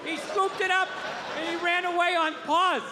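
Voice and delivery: put-on high, eager kid voice rushing through a story; childlike excited rambling. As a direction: babble like an eight-year-old who can't wait to tell it. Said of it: "I'd just be like, like eight years old".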